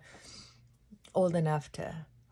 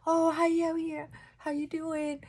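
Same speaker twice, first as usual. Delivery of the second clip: mock friendly greeting; 10 semitones higher than usual. Imitating a friendly crow greeting.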